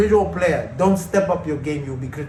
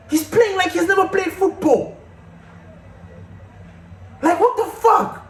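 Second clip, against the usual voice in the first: furious outburst; 12 semitones higher than usual. Real, not acted. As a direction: yell it out in disbelief.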